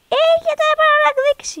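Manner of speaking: very high, loud terrified scream; real panicked scream at the game; panicked shriek. Real, not acted. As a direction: shriek as the neighbor grabs you. Caught by neighbor, panics.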